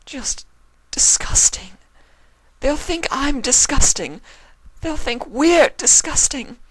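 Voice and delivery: anguished wail of shame; crying out. Acted. Octavia crying to Vinyl that her parents will think her disgusting, anguished. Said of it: "Octavia's tears began to stream down her cheeks".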